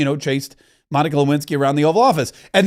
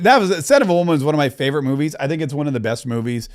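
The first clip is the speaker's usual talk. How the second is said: ordinary talk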